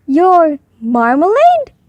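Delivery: loud, slow, disbelieving echo; puzzled repeat. A narrator's reading. Foreman echoes Paddington's word in loud slow disbelief. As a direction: repeat it as if you can't believe it. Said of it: "repeated the foreman".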